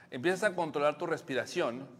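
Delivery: usual speaking voice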